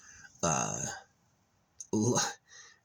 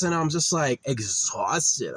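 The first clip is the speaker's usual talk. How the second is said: normal talking voice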